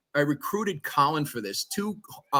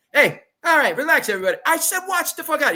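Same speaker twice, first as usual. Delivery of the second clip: loud, much higher, heated; mock heated shout; 12 semitones higher, 5 dB louder, faster than usual. Jimmy yelling back at the rappers, heated mock shout. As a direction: yell it back like he's ready to fight. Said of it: "And Jimmy goes".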